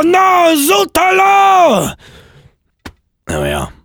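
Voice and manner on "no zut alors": very high, outraged French officer; French language police. Acted. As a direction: scold the parrot in a hammed-up French fury. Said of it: "imagine the language police turning up".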